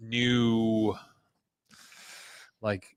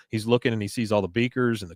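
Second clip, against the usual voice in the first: usual speaking voice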